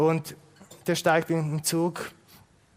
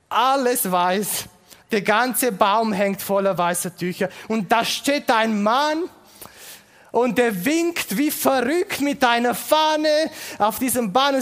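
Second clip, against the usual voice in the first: higher, louder, slow exclaiming; excited cry; 6 semitones higher, 4 dB louder than usual. Acted. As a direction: burst out at the sight of the tree. Mitreisender ruft aufgeregt. (aufgeregt rufend)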